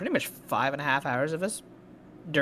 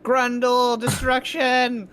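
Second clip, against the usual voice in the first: shouted battle cry; 9 semitones higher, 7 dB louder, slower than usual. Acted. Joking threat, drawn-out shout.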